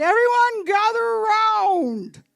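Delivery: high, loud, drawn-out announcement; calling out. Retold or imitated. Host calling guests together for a coming out announcement, calling out. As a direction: summon the whole party, stretching the words.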